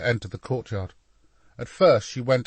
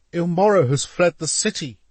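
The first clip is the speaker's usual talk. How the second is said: louder, high-pitched exasperation; frustrated; 8 semitones higher, 7 dB louder than usual. A narrator's reading. Assistant to stubborn master, frustrated. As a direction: press the point in frustration, voice up. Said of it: "replied a frustrated Francesco".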